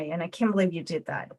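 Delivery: ordinary talk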